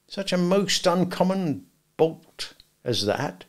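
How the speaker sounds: high, slow, earnest wonderment; solemn, confidential. A narrator's reading. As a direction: marvel at it solemnly, drawing it out. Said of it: "speaking in a confidential voice".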